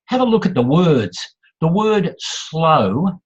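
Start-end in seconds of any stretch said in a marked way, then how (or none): 2.2-3.2 "slow" drawn right out; said slowly. Shown as a demonstration. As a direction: stretch the vowel of "slow" long and lazily. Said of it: "cannot be said fast".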